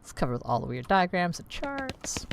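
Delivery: ordinary delivery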